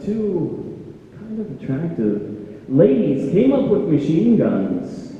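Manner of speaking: ordinary delivery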